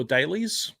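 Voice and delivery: Dailies as "DAY-leez", first vowel long; long-A pronunciation. Shown as a demonstration. Streamer asking viewers which is right, Dailies as "DAY-leez". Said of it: "which way you'd pronounce it".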